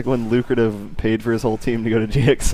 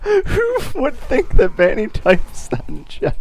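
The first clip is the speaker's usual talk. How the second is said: laughing disbelief; 13 semitones higher, slower than usual. Real, not acted.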